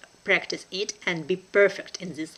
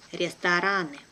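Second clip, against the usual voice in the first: brisk, natural native speed; quick native pace; 2 semitones lower than usual. Shown as a demonstration. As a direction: say it fast like a native. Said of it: "second time will be quickly, like a native".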